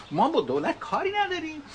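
high-pitched mock Isfahani accent; Vahdat's Isfahani accent. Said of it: "I can't imitate his, his, his sound".

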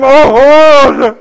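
impassioned, ringing cry. Retold or imitated. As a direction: call out the name in anguished reassurance. Women cry out to reassure Hur.

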